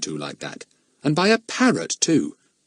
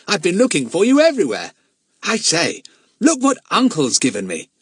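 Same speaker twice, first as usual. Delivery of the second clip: put-on higher, eager boy's voice; chattering boy; 6 semitones higher, 3 dB louder than usual. A narrator's reading. Lucian chattering to the children about his uncle, eager and higher. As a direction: babble on cheerfully.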